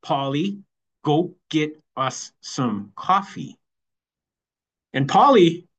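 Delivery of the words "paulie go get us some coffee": slow, word-by-word order to a husband; very slow wife voice. Retold or imitated. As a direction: draw out each word as the wife laying down the law. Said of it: "she said very slowly".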